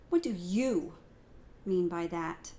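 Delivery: "What do you" loud and falling, pause before "mean by that"; stress on YOU. Shown as a demonstration. Teacher shows stress on "you" in the question. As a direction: punch "you", then pause. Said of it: "depending on the emphasis that I put on each word".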